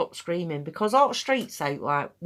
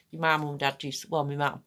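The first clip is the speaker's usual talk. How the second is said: ordinary talk, nothing marked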